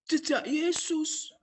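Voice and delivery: normal talking voice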